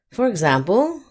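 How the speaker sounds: ordinary delivery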